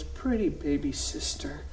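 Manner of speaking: put-on soft, slow, small child voice; little boy cooing softly at his sister; tender little boy. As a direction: coo it like a sweet toddler. Son talks sweetly to baby.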